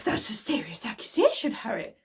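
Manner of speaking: hushed, whispery impression of a stern teacher; badly faked professor voice, whispered; McGonagall. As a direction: do a poor impression of a grave schoolmistress. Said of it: "McGonagall's like".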